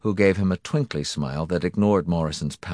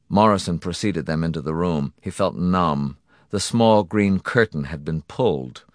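ordinary delivery, nothing marked

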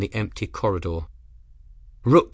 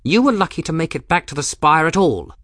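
higher, louder, haughty commodore; Rook's voice; 7 semitones higher, 6 dB louder than usual. Acted. Rook lecturing Grim on his ruined ship, higher and louder. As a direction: look down on the captain from a height.

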